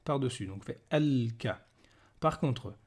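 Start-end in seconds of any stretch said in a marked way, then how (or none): 0.9-1.7 "al-ka" with the l clearly held; slow "al-ka", lingering on the l; lam pronounced. Shown as a demonstration. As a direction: sound the l of "al-ka" before the k. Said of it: "on prononce le lam s'il y a un soukoun".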